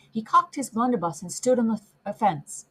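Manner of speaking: usual speaking voice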